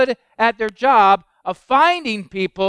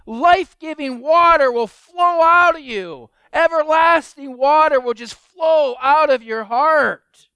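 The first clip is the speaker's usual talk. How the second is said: higher, slower, full of wonder; preacher stirred by the claim, higher and louder; amazed; 7 semitones higher, 4 dB louder, slower than usual. Real, not acted. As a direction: marvel aloud at the claim. Preacher marvels at Jesus' claim.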